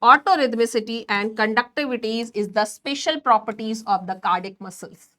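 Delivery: very slow, word by word, for notes; slow dictation. Shown as a demonstration. Teacher dictates a definition to students, slow and deliberate. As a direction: spell it out slowly so students can write.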